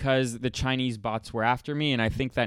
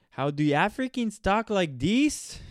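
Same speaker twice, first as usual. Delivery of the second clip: high, slow put-on Pidgin English; mock Pidgin accent; 8 semitones higher than usual. Shown as a demonstration. Mocking how Pidgin speakers talk, in a put-on Pidgin accent. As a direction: ham up a Nigerian Pidgin lilt. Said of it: "They have a language called Pidgin".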